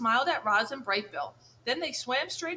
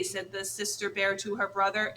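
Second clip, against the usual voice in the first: ordinary delivery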